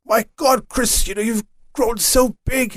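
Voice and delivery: a touch of jolly Father Christmas; Santa voice. Retold or imitated. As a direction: marvel at the boy like Santa would. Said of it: "in my best Santa accent".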